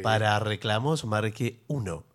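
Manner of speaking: slow, soft recorded menu voice; phone menu voice. Pretend. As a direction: read it like an automated phone line. Automated phone line menu.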